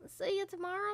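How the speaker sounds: usual speaking voice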